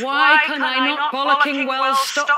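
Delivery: a playful British rant at her own cursing; mock exasperation. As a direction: scold yourself in comic frustration. Hammed-up swearing fit.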